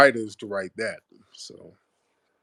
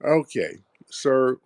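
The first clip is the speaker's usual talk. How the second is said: normal talking voice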